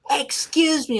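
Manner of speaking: very high imitated shriek; mock Vicky screech. Imitating Vicky's screaming catchphrase, high. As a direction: screech it like an annoying heel. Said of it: "you hear Vicky".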